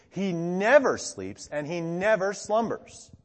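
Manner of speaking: NEVER drawn out and pitched high both times; emphatic restatement. Retold or imitated. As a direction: lean slowly on each NEVER. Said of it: "stated in a more emphatic way".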